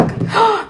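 a sharp, high intake of breath; quick startled gasp; surprised gasp. Real, not acted. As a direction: gasp at the news. Surprised at the record.

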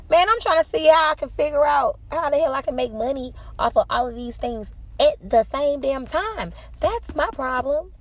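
high and loud, fired up, slowing down at the end; blurting it out like he read your mind; loud, worked-up confession that it's her problem; excited outburst. Retold or imitated. Excitedly retells her own reaction.

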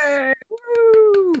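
high, loud whoop; cheering. Real, not acted. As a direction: cheer like a crowd. Cheering pregnancy news.

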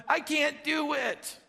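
put-on high, slow little boy whine; a light little-boy voice, drawn out; itty bitty boy voice. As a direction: play yourself as a tiny boy saying it. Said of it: "In that nice little, little itty bitty boy, [name], you know, uh, voice".

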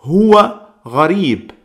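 Huwa gharib slow and careful in Arabic; Arabic phrase. Shown as a demonstration. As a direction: model the Arabic phrase for learners. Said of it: "podemos decir".

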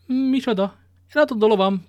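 high, loud outburst of disbelief; indignant surprise. Acted. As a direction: burst out, shocked at the news. Athos learns his horse is sold. (felháborodott, hangos meglepetés)

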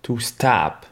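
American stop; "stop" with an O that sounds like A, "stahp"; American English. Shown as a demonstration. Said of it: "le O se dira un peu plus A". (en américain, "stop" avec un O proche du A)

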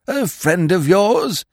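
put-on high, fluttery voice of a vague old lord; a dithering aristocrat caught off guard, pitched high; flustered old earl. Vague old earl startled by his secretary, high and flustered.

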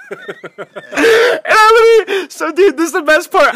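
high, loud words squeezed through laughter; real helpless laughter. Real, not acted. Storyteller reaching the best part, cracking up. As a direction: crack up while pushing the story on.

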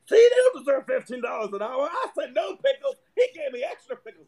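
high, whiny complaint; mock outraged customer. Customer complaining on Facebook about pickles, whiny mock outrage. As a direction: whine like an entitled Facebook poster.

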